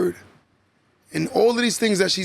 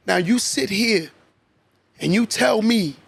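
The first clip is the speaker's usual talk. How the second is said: usual speaking voice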